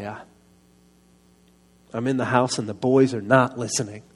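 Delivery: ordinary delivery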